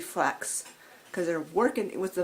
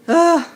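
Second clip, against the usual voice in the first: frustrated ugh; 9 semitones higher, 11 dB louder than usual. Real, not acted. Counter messy again.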